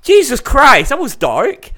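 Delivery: high, loud, shocked outburst; startled by a dark joke. Real, not acted.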